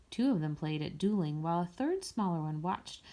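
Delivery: usual speaking voice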